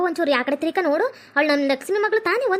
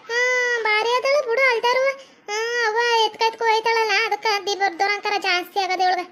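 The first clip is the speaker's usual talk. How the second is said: grumbling mother-in-law; 6 semitones higher than usual. Acted. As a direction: complain in a put-on high old-woman voice. (ಗೊಣಗುವ ಅತ್ತೆಯ ಎಳೆದ ಎತ್ತರದ ಧ್ವನಿ)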